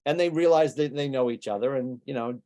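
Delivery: normal talking voice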